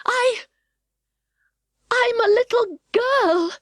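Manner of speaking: put-on high, slow, unsure girl voice; doubtful high girl voice; doubtful little girl. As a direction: hesitate like a child unsure of herself. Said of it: "said Alice rather doubtfully".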